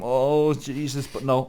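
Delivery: ordinary talk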